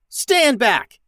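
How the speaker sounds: high, loud warning yell. A narrator's reading. Max warns crew to stand back, yelling. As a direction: shout them back from the door. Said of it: "yelled Max".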